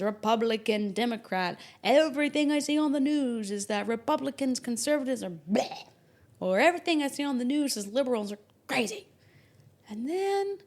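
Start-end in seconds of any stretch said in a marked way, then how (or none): none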